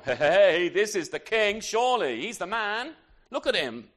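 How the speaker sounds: loud eager calling out, hey hey; an impressed prophet loudly pointing out the king; excited exclaim. Retold or imitated. Samuel, struck by Eliab's looks, calls out loudly.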